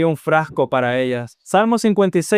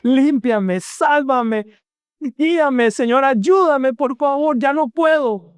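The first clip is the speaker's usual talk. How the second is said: high, pleading voice close to tears; a broken sinner crying out to God; tearful pleading prayer; 8 semitones higher, slower than usual. Acted. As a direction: beg the Lord as if weeping.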